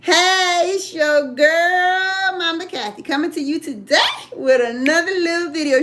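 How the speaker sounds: lightly sung-out greeting to viewers; a hint of a singsong show opener; her own video intro voice. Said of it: "It'd be like".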